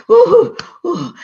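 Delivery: out of breath. Acted. Out of breath after chase.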